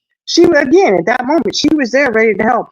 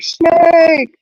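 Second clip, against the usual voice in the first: put-on kids yelling snake; 10 semitones higher, 4 dB louder than usual. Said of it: "they're like".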